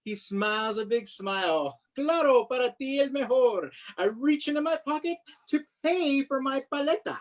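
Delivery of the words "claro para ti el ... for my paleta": usual speaking voice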